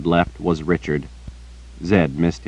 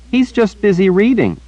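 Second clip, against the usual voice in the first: put-on high, earnest little girl chattering; bright-eyed child repeating gossip to the old wizard; 4 dB louder than usual. A narrator's reading. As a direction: pitch it up like a young girl telling news. Child Rachel voiced.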